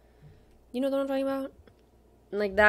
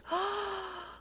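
high, sharp intake of breath; real gasp of delighted recognition; excited gasp; 12 semitones higher, 6 dB quieter than usual. Real, not acted. Collector finding the card, excited gasp.

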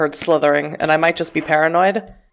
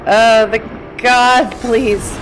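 loud, high pleading; frightened player pleading loudly; desperate plea; 5 semitones higher, 4 dB louder, much slower than usual. Real, not acted. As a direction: beg for the shot to land. Desperate moment in fight.

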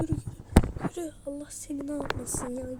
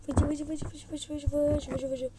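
playful tablet noise; 2 semitones higher than usual. Pretend. Playing with the tablet.

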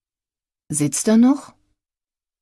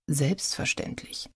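quiet, dry sarcastic selbstverständlich, rising at the end; much softer, mocking rise on Selbstverständlich; sarcastic echo; 10 dB quieter, slower than usual. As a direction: echo her word with soft mockery. Sarcastic echo of her word.

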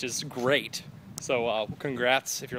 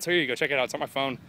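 usual speaking voice

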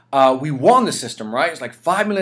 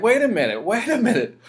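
higher and louder indignant protest; retold rising anger; 9 semitones higher, 3 dB louder than usual. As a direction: push back as the anger starts. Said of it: "now I started getting mad".

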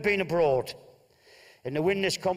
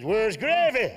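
indignant demand; 6 semitones higher, 6 dB louder, slower than usual. Acted. Husband demands gravy.

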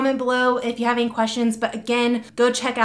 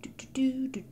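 whispering; 3 semitones higher, 12 dB quieter than usual. Real, not acted. Quiet aside while waxing.

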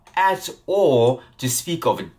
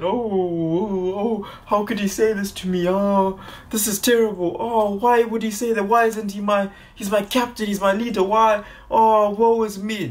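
higher, hammed-up whiny wailing; mock crying; 7 semitones higher than usual. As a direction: ham up a self-pitying wail. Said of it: "go in a ball and cry".

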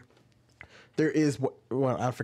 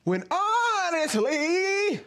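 very high, hugely stretched mock delivery; exaggerated character voice; much slower than usual. Asking who your audience is, exaggerated high character voice. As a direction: parody an over-performed video voice, drawn way out. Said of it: "you feel like you need to go".

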